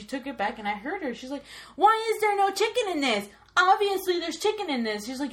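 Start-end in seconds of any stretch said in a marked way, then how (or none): 1.8-5.1 louder, higher indignant scolding; angry manager. Retold or imitated. Manager scolds her worker, angry and loud. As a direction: scold the worker for the missing chicken. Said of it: "she was pissed".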